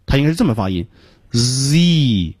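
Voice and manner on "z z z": long continuous buzz on "Z"; stretched, unblocked "Z"; non-plosive Z. Shown as a demonstration. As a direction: let air leak from the start of "Z". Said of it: "所以没有爆破音".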